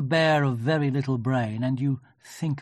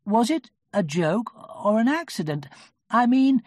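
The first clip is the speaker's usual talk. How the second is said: kindly Pooh voice; 9 semitones higher, 6 dB louder than usual. A narrator's reading. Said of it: "said Pooh".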